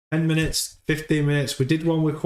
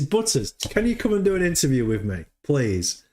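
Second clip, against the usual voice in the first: normal talking voice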